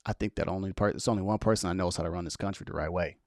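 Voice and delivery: hushed, low and secretive; retold whisper. Telling the older lady his pick, a hushed mock whisper. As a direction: confide it under the breath. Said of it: "and I whispered to her".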